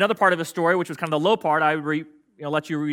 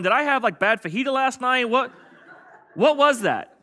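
groggy bewilderment; 7 semitones higher than usual. Retold or imitated. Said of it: "you're waking up the next morning going".

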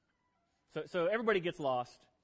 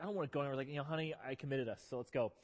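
softer, lower quoted exchange; tired wife refusing dinner, quietly; tired wife and pleading husband; 5 semitones lower, 8 dB quieter, faster than usual. Retold or imitated. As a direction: mutter it low and tired. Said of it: "It's like".

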